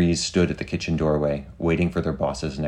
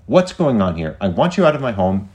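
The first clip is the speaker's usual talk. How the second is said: higher, louder protest; upset demand; 5 semitones higher, 6 dB louder than usual. Acted. Homeowner telling secret service to leave, upset and loud. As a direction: order intruders out with raised voice.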